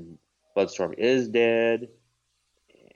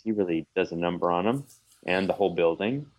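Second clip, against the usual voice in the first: normal talking voice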